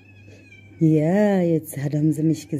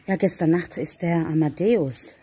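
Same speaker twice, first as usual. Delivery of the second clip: ordinary talk, nothing marked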